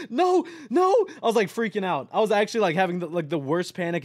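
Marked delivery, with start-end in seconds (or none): 0.1-0.9 1 panicked no. Retold or imitated. Retold panic on clothes.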